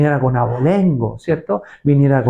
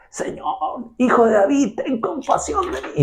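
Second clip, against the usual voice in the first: rising high plea; pleading cry; 8 semitones higher, 4 dB quieter than usual. Retold or imitated. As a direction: cry out for mercy. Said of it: "Porque gritaba". (ruego agudo de la mujer)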